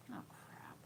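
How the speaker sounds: under-the-breath whisper; whispered oh crap. Real, not acted. Finding ripped pages in a book, a dismayed whisper. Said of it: "finding missing pages".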